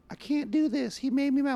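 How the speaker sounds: high-pitched mock whine of a child; mock whiny kid. Dad imitating his complaining kids, high mock whine. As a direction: whine like a kid who got upset.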